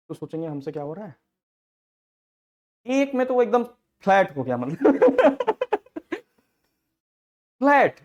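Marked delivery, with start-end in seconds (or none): 4.6-8.0 laughing. Real, not acted. Laughing at drunk going flat.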